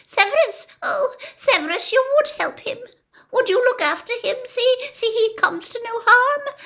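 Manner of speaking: high, breathless pleading; Narcissa, desperate hope. A narrator's reading. Narcissa begs Snape to protect Draco, high and fast. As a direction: rush it out, begging.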